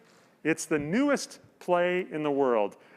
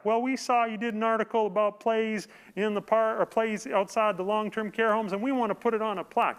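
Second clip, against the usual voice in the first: higher, mock Arkansas telemarketer accent; pushy Arkansas saleswoman accent, hammed up; Arkansas caller accent; 6 semitones higher than usual. Host retelling the caller's reply in a higher mock Arkansas accent. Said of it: "that's a horrible Arkansas accent".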